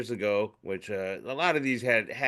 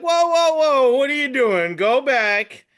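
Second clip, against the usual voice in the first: high, loud startled whoa, whoa; alarmed protest; 13 semitones higher, 9 dB louder than usual. Retold or imitated. To his wife in the car, a startled loud protest. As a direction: jump in to stop her changing the station. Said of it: "and I was like".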